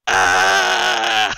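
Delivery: low, loud hammed-up death yell; mock death cry. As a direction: overplay the dying scream. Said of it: "He goes".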